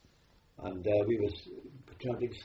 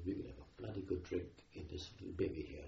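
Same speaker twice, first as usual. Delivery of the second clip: quietly; 3 semitones lower, 7 dB quieter than usual. Retold or imitated. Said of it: "he said quietly".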